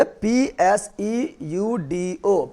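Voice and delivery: letters पी एस ई यू डी ओ spoken one by one, very slowly; slow spelling out. Shown as a demonstration. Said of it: "सीडोसिलोमेट को पी से लिखेंगे". (धीरे-धीरे अक्षर बोलकर)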